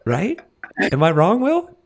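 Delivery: high, loud, playful call; teasing, loud appeal to cohost Will; excited appeal. Real, not acted. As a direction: shout the question up to him.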